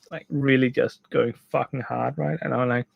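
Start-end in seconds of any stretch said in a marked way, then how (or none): none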